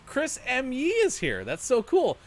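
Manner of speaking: high, loud, bubbly host; excited. Shown as a demonstration. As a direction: greet the guest with big energy. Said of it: "Can I get a rewind".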